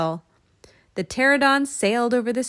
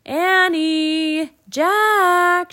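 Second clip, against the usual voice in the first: long, loud, high name calls; mother hollering for the children; calling out; 10 semitones higher, 10 dB louder, much slower than usual. A narrator's reading. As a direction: carry each name across a distance. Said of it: "she called".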